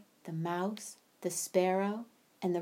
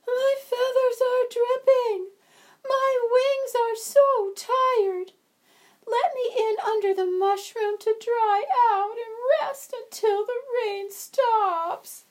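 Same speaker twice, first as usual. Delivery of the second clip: very high, slow, louder tearful chirp; crying sparrow; 8 dB louder than usual. Acted. Tired wet sparrow cries to be let in, tearful and high. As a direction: weep the words like a tired little bird.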